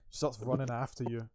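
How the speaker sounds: usual speaking voice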